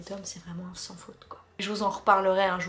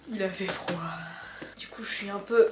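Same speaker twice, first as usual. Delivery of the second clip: chuchoté las. Real, not acted. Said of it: "fatiguée de ma journée".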